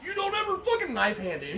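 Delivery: angry drill sergeant. Retold or imitated. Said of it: "And he's like".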